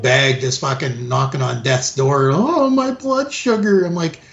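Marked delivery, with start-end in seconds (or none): 2.3-3.8 mock frail old woman. Old woman voiced in story.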